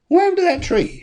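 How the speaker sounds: playfully baffled squeal of a question; mock astonishment. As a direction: act out sudden high-pitched bafflement. Acts out sudden puzzlement at bare tree.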